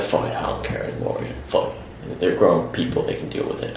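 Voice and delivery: low, hushed grumble of giving up; imitated dismissive muttering. Friend giving up on the search, dismissive muttering. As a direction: mutter it off under the breath.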